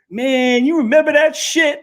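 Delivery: high, strained Tyson-style voice; Mike Tyson impression. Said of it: "he goes".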